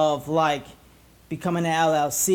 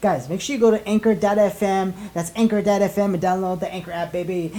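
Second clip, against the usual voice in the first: ordinary talk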